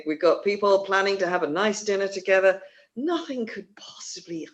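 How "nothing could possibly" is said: whispered, teasing doom; mock-sinister whispered aside; ominous whisper. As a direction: breathe it like a dark hint of trouble. Ominous aside about dinner plans.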